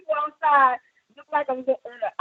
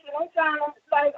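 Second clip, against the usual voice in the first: ordinary talk, nothing marked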